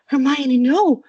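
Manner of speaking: loud, high cry of alarm; terrified. A narrator's reading. Mother warns daughter away from the owl, terrified. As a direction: cry out to stop her, frightened.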